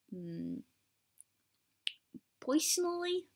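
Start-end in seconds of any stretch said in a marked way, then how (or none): 2.4-3.2 high New Jersey "poysonally"; attempted American accent. Shown as a demonstration. Vlogger attempting a whole sentence, high New Jersey accent. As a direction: pitch personally up with a New Jersey oy. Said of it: "think of a sentence I could say".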